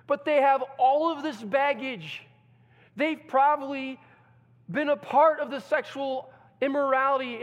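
loud, high preaching; fiery preaching. Acted. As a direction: pour it on, voice climbing. Preaching builds again.

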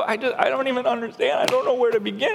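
high, hurried protest of confusion; mock overwhelmed beginner. Preacher voicing a struggling new Bible reader, mock helplessness. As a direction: lightly play someone at a loss.

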